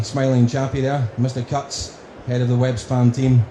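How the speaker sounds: usual speaking voice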